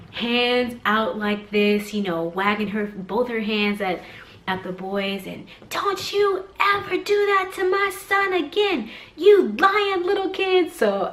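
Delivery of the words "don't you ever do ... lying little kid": high, slow scolding imitation; mock yelling. Angry mom yelling at the toddlers about her son, scolding. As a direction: imitate an outraged mother telling kids off. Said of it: "She's yelling at them".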